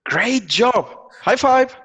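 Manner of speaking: high, loud cheerful praise; excited praise. Shown as a demonstration. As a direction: cheer the student on like a kids' teacher. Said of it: "teacher praising pretend student".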